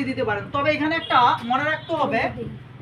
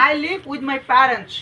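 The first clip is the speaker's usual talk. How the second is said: slow dictation; much slower than usual. Shown as a demonstration. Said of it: "teacher dictating a model sentence".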